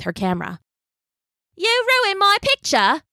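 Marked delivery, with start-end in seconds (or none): 1.5-3.0 high, slow, put-out tourist; complain, indignant; annoyed tourist. Acted. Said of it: "said the tourist".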